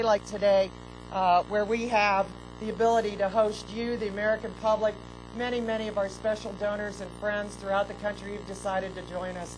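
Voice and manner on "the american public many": normal talking voice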